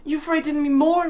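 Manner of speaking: put-on high, loud boyish protest; frightened boy. A narrator's reading. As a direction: squeal it back. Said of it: "replied the boy".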